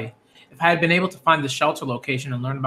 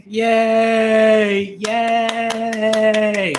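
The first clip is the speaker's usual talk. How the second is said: rising higher and louder into a sung cheer; overjoyed disbelief; 6 semitones higher, 3 dB louder, much slower than usual. Acted. Emma greeting Norman back from the dead, overjoyed singing cheer. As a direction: burst with joy at a friend come back. Emma's reunion line read aloud.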